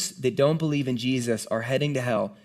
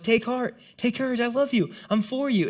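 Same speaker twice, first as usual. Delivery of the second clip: higher, gentle reassurance; a light hint of Jesus comforting a friend; tender Jesus; 8 semitones higher than usual. Jesus voiced comforting fallen Paul.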